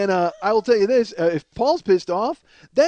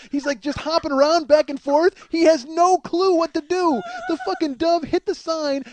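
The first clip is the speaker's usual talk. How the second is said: ordinary talk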